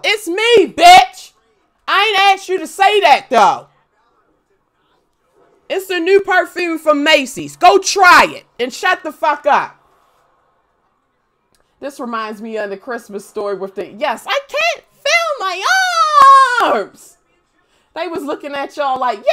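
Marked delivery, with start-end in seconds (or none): 0.1-9.8 yelled high sassy retort; loud clapback. Retold or imitated. Kid snapping at whoever smelled Taco Bell, yelling. 13.9-17.2 high slow whiny; mock stiff kid. Joking about Christmas Story puffy coat, whining high.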